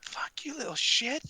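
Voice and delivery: whispered shout, mostly breath; mock distant yell. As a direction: fake a yell from afar under your breath. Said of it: "There's another person yells at us".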